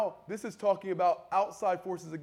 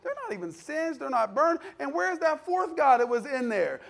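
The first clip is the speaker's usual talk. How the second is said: high, increasingly loud disbelief; imitated astonishment; 9 semitones higher, 7 dB louder than usual. Onlookers at the unburned men, high loud astonishment. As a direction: act out onlookers marvelling, voice pitched up.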